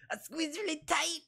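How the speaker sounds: very high, strained squeeze; movie host impression. Host showing off his exercises, high strained comic voice. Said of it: "And he goes".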